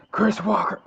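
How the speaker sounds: sudden high shout. Acted. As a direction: yell the name out.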